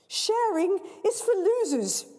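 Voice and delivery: put-on high, slow sneering girl; spoilt girl. Greedy girl scorning the host, high slow sneer. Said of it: "says the girl".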